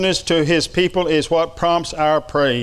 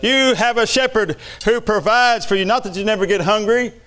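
preaching; 4 semitones higher than usual. Acted. Preaching at rising intensity.

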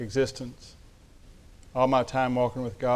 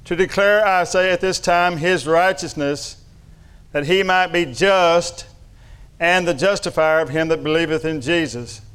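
emphatic scripture reading; 3 semitones higher, 3 dB louder than usual. Acted.

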